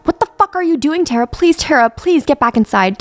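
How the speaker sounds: high, loud desperate plea; frantic plea to a friend on a ledge; frantic pleading. Acted. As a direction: beg her to get back inside. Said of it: "frantically pleading with her".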